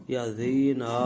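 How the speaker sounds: زینا with the vowels dragged out, loud; wrongly lengthened vowels. Shown as a demonstration. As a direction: stretch زینا to a long final aaa. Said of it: "مت کریں".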